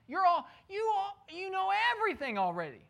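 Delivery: high mock flattery; sarcastic. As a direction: sneer it in a high voice. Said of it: "He's talking about full in the mind".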